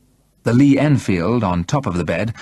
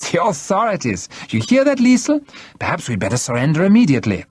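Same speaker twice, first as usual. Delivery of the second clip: very high, exaggerated derisive alarm; mockery; 9 semitones higher than usual. A narrator's reading. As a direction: jeer at an empty threat. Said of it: "in the face of von Rellstab's mockery".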